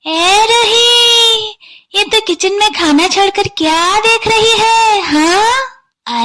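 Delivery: high, loud, drawn-out call of the bahu's name; stern mother-in-law calling out across the house; scolding mother-in-law call. Acted. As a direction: stretch the name out like a nagging mother-in-law shouting from the kitchen. Mother-in-law calls daughter-in-law.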